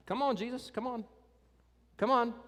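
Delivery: high, pleading come on; impatient Jairus. Retold or imitated. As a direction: hurry Jesus along, half acted. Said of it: "Jairus is probably like".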